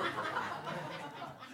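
real high breathy laugh at his own punchline. Real, not acted. Preacher laughing at his own joke to congregation, soft airy laugh.

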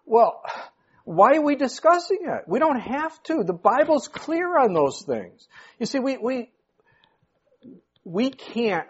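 high, indignant fiery preacher; preaching. Acted. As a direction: challenge the congregation hard. Preaching at full force.

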